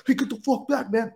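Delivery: imitating a rattled recruit losing his cool; flustered snap back. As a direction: push them off like a rattled rookie. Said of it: "If you're gonna be like".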